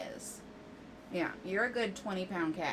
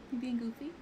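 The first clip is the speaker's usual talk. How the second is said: high, soft cooing question; soft pet talk; 4 semitones higher, 4 dB quieter, faster than usual. Real, not acted. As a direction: ask the cat gently in a pet voice. Cooing at the cat.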